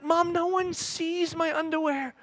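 high, slow mock protest; hammed-up pleading disbelief; exasperated with mom. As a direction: spell it out for mom, drawn out. Arguing with mom.

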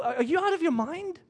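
high-pitched, disbelieving question; mock incredulity. Husband answers his wife's absurd rule, mock incredulous. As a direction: ask it in acted disbelief.

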